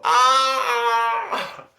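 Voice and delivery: high yelp of pain; imitating a player getting hit in the eye; sudden high cry of pain; mock pained scream. Opponent hit in eye by mud.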